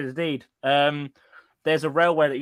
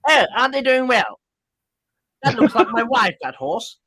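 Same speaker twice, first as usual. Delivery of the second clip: higher, louder mock-posh gent with a laugh; 6 semitones higher, 5 dB louder than usual. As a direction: put on a South Park-style posh voice. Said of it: "my posh voice sounds like it's fucking South Park".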